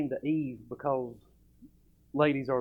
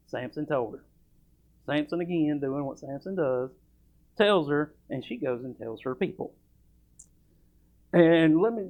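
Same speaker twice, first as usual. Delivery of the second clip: usual speaking voice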